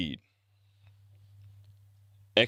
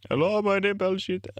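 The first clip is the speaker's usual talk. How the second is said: higher mock Indian accent, hammed up; a hammed-up Baljeet caricature with a mock Indian accent, pitched up; 11 semitones higher than usual. Vlogger shouts out the cartoon character Baljeet, then a higher, hammed-up mock Indian accent. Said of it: "Shout out to Baljeet".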